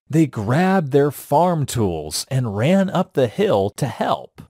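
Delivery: plain reading voice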